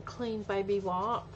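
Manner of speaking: usual speaking voice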